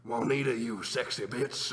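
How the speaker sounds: slow, lightly voiced lecherous janitor; a hint of a sexually aggressive character; mock come-on, drawn out; Randy. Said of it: "a very sexually aggressive".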